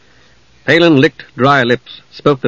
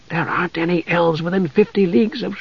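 soft, higher-pitched hushed protest; lowered to a whisper; 5 semitones higher, 6 dB quieter than usual. A narrator's reading. Mage protests to the knight, hushed and urgent. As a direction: keep your voice down but insist. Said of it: "lowered his voice to a whisper".

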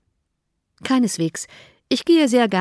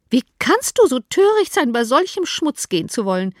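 scolding mother; 5 semitones higher than usual. Acted. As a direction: cry out in outrage at the daughter. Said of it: "rief die Mutter".

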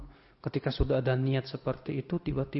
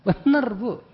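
laughing; 8 semitones higher, 6 dB louder, slower than usual. Real, not acted. Laughing at his own story.

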